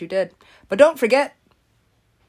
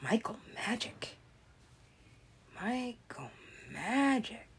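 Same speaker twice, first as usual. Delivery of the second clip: whispered name; 12 dB quieter than usual. Real, not acted. Calling a name softly.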